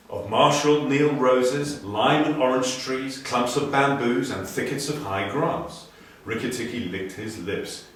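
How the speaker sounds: ordinary delivery, nothing marked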